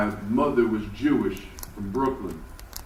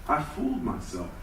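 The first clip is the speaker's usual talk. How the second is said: ordinary talk, nothing marked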